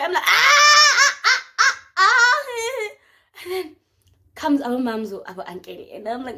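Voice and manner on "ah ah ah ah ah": loud, high repeated shrieks; hammed-up yelps of pain; screaming. Coach tries her shoulder, loud high mock screams. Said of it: "I was screaming".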